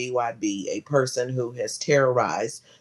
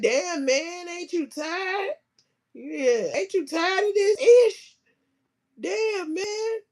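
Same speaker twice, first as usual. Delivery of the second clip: high mocking voice; slower than usual. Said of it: "He said".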